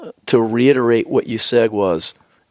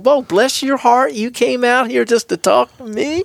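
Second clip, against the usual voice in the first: high, slow imitated Southern drawl; 14 semitones higher than usual. Woman thanking the cooks for coming out, imitated Southern drawl. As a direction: put on a high-pitched, stretched-out Southern accent. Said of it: "she's in her Southern drawl".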